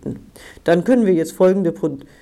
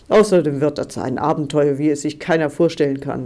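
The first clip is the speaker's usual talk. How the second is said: ordinary delivery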